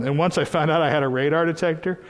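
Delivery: ordinary talk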